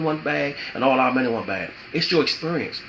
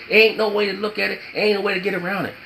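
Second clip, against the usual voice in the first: normal talking voice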